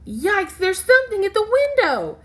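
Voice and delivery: high, loud startled cry; alarmed. Acted. Child sees something at the window, high and alarmed. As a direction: yelp it in sudden fright.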